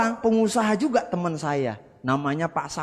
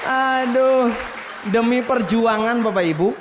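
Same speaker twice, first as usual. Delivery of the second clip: exclaiming; 5 semitones higher, 5 dB louder than usual. Real, not acted. Exclaims his struggle to audience.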